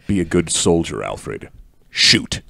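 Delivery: low, hushed order to fire; calm, grim command. Acted. Bruce orders Alfred to shoot.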